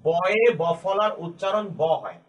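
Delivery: the whole rule read in one slow, even run; slow full rule. Shown as a demonstration. Teacher repeating the rule to students in one slow run. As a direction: say it through slowly so it sticks. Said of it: "এই নিয়মটিকে আমি এভাবে বলতে পারি".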